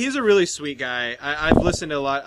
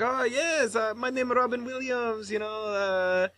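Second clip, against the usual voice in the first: put-on higher-pitched comic Russian accent; imitated Russian accent; 7 semitones higher than usual. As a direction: imitate a comedian playing a Russian newcomer. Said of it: "He does a Russian accent".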